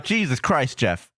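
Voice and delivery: normal talking voice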